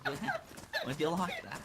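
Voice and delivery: posh British impression. Friend playing a posh Briton offering tea. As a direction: offer tea like a prim Brit. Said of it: "best British impressions".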